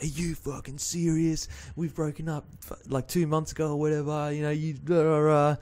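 retold tearful protest, trailing into blahs; imitating upset ex-girlfriend. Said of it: "bawling her eyes out".

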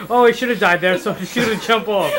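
loud mock voice of the loser; mocking quote. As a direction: mimic him, laughing. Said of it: "you just decide to say".

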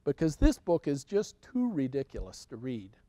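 ordinary delivery, nothing marked

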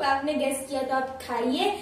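usual speaking voice

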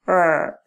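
a rolled rrr held alone; rolled r sound. Shown as a demonstration. Teacher demonstrating the trilled rrr to students. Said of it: "después de hacer el sonido de la R".

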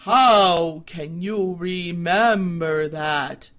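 slow, deliberate, wise scarecrow voice; measured like a patient old judge; calm stern scarecrow. A narrator's reading. Scarecrow questions.

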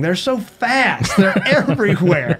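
high, slow, laughing through the words; giggling at the memory; laughing while telling. Real, not acted. The host hears him laugh through the soccer defeat.

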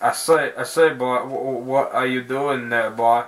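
put-on old-fashioned southern accent, blustering and stammering; hammed-up old southern gentleman accent; Foghorn Leghorn. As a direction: stutter it out like a southern cartoon rooster. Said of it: "like, foghorn leghorn southern".

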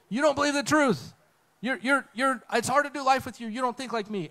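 high, exasperated complaining; pleading frustration in a high voice; exasperated whine. Retold or imitated. Woody complaining to Buzz, exasperated. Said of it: "this picture of".

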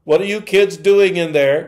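mother calling out. Retold or imitated. Mother calling through a closed door to her kids, suspicious. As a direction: catch the kids at it.

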